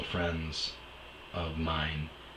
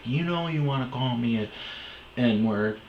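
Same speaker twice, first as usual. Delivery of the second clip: taunting ex; 6 semitones higher than usual. Retold or imitated.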